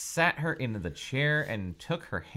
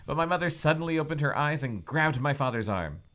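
ordinary delivery

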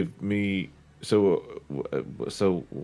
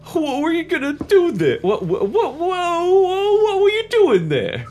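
shrill, loud goofy character voice; high-pitched Triad voice; 9 dB louder than usual. Acted. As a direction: squeal it out high and loud, almost laughing.

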